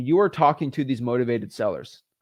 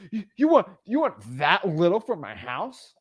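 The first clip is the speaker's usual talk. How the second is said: high, stammering incredulous seller; hammed-up offended question; mock disbelief; 3 semitones higher than usual. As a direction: act affronted by a lowball offer, slowing down.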